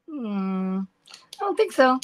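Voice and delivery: put-on slow, drawn-out teasing no from a little girl; granddaughter's sly refusal. Playing the granddaughter refusing grandma's trade, slow and teasing. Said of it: "she looked at me like".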